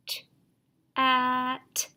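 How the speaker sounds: Ch and at said apart; student sounding out Ch-at; segmented sounds. Shown as a demonstration. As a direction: split chat into Ch-at. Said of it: "Your turn".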